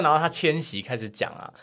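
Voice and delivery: ordinary delivery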